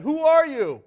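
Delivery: a high, loud cry upward; startled cry. Acted. Saul struck blind on the road cries out to the voice, loud and high. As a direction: call out to the unseen voice.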